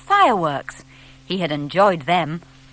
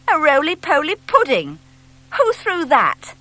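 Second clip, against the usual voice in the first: much higher, louder astonished cry; a boy baffled by what landed; amazed boy; 8 semitones higher, 4 dB louder than usual. Acted. Boy startled by falling owl.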